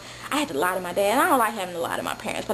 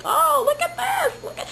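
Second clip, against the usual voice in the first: put-on naive wide-eyed girl. Naive teen on the street, marveling in a high voice. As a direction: gasp like a sheltered girl seeing sights. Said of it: "I'm just like".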